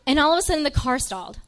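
ordinary talk

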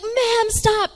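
high, loud imitated paramedic; acted-out insistent shout; exasperated order. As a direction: cut off a struggling patient.

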